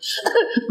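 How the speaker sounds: a quick high burst of laughter; short high laugh. Real, not acted. Preacher laughing at his own story, short high laugh. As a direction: laugh out, amused.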